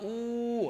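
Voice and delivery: long, held ఊ; ఊ prolonged as in chanting Om; sustained vowel. Shown as a demonstration. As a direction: draw ఊ out steadily on one breath. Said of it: "దాన్ని కొంచెం కొనసాగించాం".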